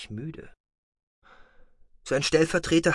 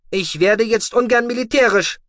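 higher, louder voice of a dry, lecturing commander; a calm officer scolding his deputy; stern commander; 12 semitones higher, 7 dB louder than usual. Acted. Commander dryly lecturing his complaining deputy, raised and higher. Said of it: "sagte Gural".

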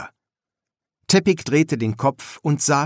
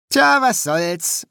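high, loud, drawn-out happy shrug; carefree, sing-out dismissal; cheerful; 10 semitones higher, 5 dB louder, slower than usual. A narrator's reading. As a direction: brush it off merrily. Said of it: "sagte Shelter fröhlich".

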